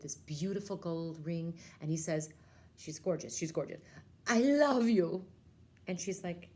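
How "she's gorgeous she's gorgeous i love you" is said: swooning, louder on love you; lovestruck prince. Acted. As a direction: gush like a smitten suitor. Prince proposing.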